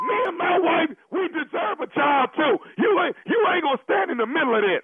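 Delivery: high-pitched, heated protest; mock indignant. Caller protesting to husband blocking his plan, mock indignant. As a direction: plead your case indignantly, pitch way up. Prank caller arguing back.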